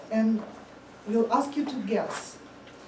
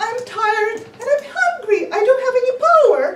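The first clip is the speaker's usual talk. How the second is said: very high, loud, fast laughing wail; hammed-up sobbing squeal; mock wailing mouse; 11 semitones higher, 10 dB louder, faster than usual. The hopeless mouse wails at the support agent, hammed up.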